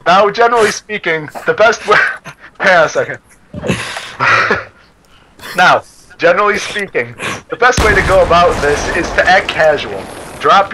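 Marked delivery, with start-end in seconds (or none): 3.5-4.6 breathy giggle. Real, not acted. Breaks up laughing.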